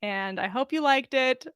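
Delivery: plain reading voice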